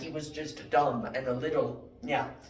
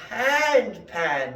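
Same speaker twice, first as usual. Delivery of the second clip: insisting; 6 semitones higher, 7 dB louder than usual. Retold or imitated. Philosopher insists.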